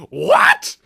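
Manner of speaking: squeaky high cracking shout. Retold or imitated. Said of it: "hit a new octave like".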